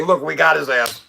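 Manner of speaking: hammed-up gloating; mock gloating. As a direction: play the trolls celebrating their win. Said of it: "You say".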